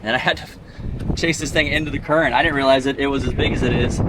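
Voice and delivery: loud, high, thrilled; excited. Real, not acted. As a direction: still buzzing after the dive. Pumped up after the dive.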